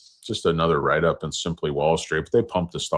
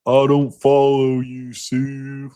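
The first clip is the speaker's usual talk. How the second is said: higher, louder, slow dopey cowboy; dim cowboy voice; 8 semitones higher, 6 dB louder, slower than usual. Retold or imitated. Mocking Costner's cowboy as dense, higher and slow. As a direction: play it confused and dense. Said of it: "you're supposed to be a cowboy".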